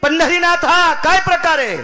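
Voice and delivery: high, loud kirtan cry to the gods; preaching at full force. Acted. Preacher crying out to the gods in kirtan. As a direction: belt it out like a temple preacher.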